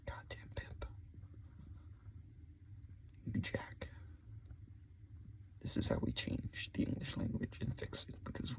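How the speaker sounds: a conspiratorial whisper; whispered cursing. Acted. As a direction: breathe the words in a hush.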